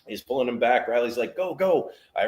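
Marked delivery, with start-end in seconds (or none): 1.4-1.9 urgent go. Retold or imitated. Cowboy urging him in.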